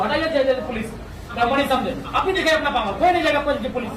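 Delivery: loud, forceful demand; loud protest. Real, not acted. Protest leader to officials about police guarding the company, loud and forceful.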